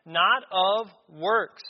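"Not" rising, loud and drawn out, "of works" slow; stress on not. Shown as a demonstration. Preacher stressing to the church, loud slow "Not of works". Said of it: "it's emphasized here".

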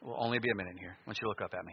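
ordinary talk, nothing marked

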